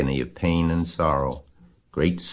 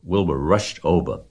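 usual speaking voice

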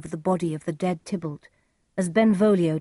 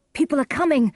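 high, urgent warning; crying out; 9 semitones higher, 4 dB louder, faster than usual. A narrator's reading. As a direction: warn a friend in a raised pitch. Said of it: "cried Benvolio".

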